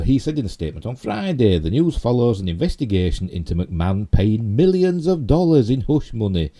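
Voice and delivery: projected news-story reading. Real, not acted. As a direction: read the story out with high-pitched stresses. Reads news article aloud.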